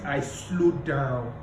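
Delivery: slow, weighted words; slow down on the point that matters. Shown as a demonstration. Coach showing slowing for importance. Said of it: "I can start speaking really".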